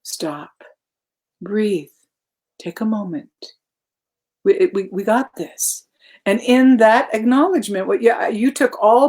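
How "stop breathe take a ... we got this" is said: quoting a calm friend, hushed and reassuring; calm soothing advice. Retold or imitated. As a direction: talk her down gently, pausing. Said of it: "I'll tell you what you said".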